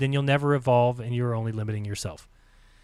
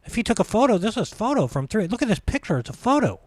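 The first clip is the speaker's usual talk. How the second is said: higher mocking know-it-all voice; smug debunker; 5 semitones higher than usual. Mocking article readers who scoff at flat earth, higher sneering voice. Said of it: "they'll say".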